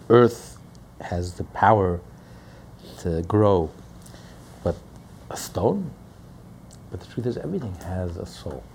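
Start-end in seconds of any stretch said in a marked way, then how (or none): 4.6-5.9 whispered question. Acted. Rhetorical hushed question.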